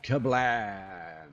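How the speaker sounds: punchy cartoon kablam; mock explosion. Shown as a demonstration. Showing off the rocket salvo, a kablam. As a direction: shout the blast as a sound effect. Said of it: "watch this".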